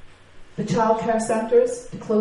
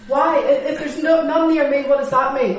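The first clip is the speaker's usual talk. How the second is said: raised, louder, hammed-up alarm; higher pitched mock fretting; mock panic; 4 semitones higher, 5 dB louder than usual. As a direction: act out frantic self-concern. Mocking panic over nearby cases.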